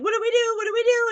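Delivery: high, urgent panicked quote; mock panic. Woman asking partner as bear charges, mock-panicked. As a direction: imitate someone panicking, pitch jumping up.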